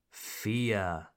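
low, soft and eerie; hushed spooky whisper. Acted. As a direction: breathe the word like a ghost story. Dramatic repeat in hush.

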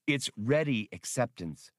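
usual speaking voice

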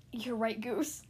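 ordinary delivery

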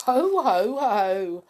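loud jolly postman laugh; big belly laugh, ho ho ho; hearty ho ho. Acted. Postman laughing at the pixies' mistake, loud and jolly.

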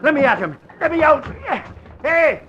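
loud, urgent calls; urgent shout. Acted. Axford shouting as he jumps out to stop the man. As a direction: charge out of the car yelling.

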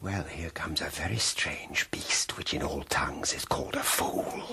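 low hushed whisper; mock him under your breath; a sly, secret whisper. A hushed inner voice comments.

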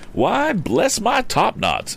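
high, slow exclamation of an odd gentleman; Mr. Damon exclaiming. A narrator's reading. Odd gentleman meets the young inventor, exclaiming. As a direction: draw it out high in amazement. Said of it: "exclaimed the odd gentleman".

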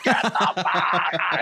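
slow, laughing mock shout into a mic; mock yell. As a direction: bark it out, half laughing. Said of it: "he yells it on the microphone".

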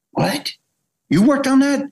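stunned surprise. Retold or imitated. Gosling stunned by the news.

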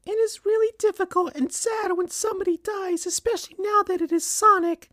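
very high falsetto mother voice; mournful but composed, in a put-on falsetto; really sad, not crying. A narrator's reading. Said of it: "not crying but sounding really sad".

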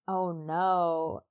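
usual speaking voice

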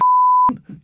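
a short, high burst of mocking laughter; scoffing laugh. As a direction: laugh the complaint off. Laugh inside retold retort.

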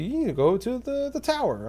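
high, swooping owl voice; owl character voice. Acted. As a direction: play a game owl giving directions. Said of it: "the owl comes up to you".

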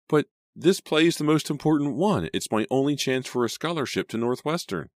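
high, pleading voice; distressed protest. A narrator's reading. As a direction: argue desperately to keep it. Heroine pleads against quitting play.

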